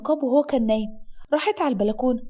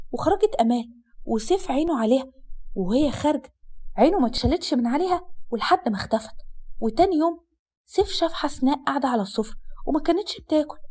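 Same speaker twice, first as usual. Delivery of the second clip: ordinary delivery, nothing marked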